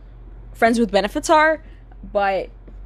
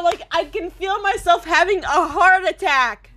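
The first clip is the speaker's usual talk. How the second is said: ordinary talk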